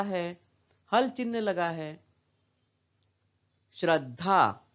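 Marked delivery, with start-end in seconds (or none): 3.7-4.7 श्रद्धा as 'shrad-dhaa' with a held द; half consonant. Shown as a demonstration. Teacher modelling the word for children, श्रद्धा as 'shrad-dhaa'. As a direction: stop on the half द, then release धा. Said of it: "इसको हम आधा ही उच्चारण कर रहे हैं".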